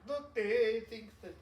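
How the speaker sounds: slow, high hint of Russian accent; attempted Russian accent. Joking about the Russians, lightly attempted Russian accent. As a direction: start a tentative Russian accent, drawn out. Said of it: "I'm not gonna do a Russian accent".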